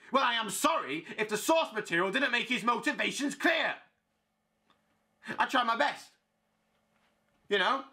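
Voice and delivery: high-pitched, wounded mock apology; indignant sarcasm. Playwright answering critics of Hamlet, sarcastic and indignant.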